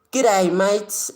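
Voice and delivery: high, loud mock Australian accent; Aussie accent. Streamer hailing Australian viewers in a loud put-on Aussie accent. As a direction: call it out in exaggerated Aussie. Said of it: "The Aussies and their accents".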